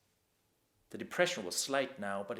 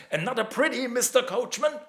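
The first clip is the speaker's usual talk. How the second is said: much higher, much louder jeering call; rowdy drunken mock offering; taunting yell; 12 semitones higher, 10 dB louder than usual. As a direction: shout the bait like a carnival barker to a monster. Drunk boys taunting Clayton.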